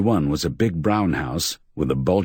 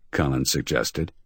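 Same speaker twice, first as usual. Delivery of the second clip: ordinary delivery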